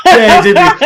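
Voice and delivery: laughing high and loud through the words. Real, not acted. Laughing at shared joke.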